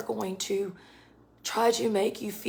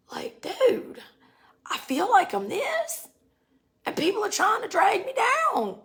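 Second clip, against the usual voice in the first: higher, anxious, pleading voice; voicing a confused seeker; 8 semitones higher than usual. A doubting listener venting, high and anxious. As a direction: sound lost and pulled every way. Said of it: "and you're like".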